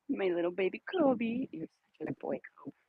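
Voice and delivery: soft, cooing praise for a little dog; doting pet talk. Real, not acted. Owner cooing softly to her little dog Kobe. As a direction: sweet-talk the dog gently.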